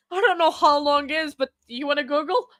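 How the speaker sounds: ordinary delivery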